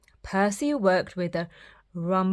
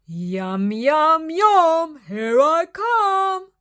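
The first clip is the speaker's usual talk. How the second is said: rising, louder, slow greedy glee; gleeful wolf; 7 semitones higher, 5 dB louder, much slower than usual. Acted. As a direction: savour it hungrily. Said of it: "laughed the wolf".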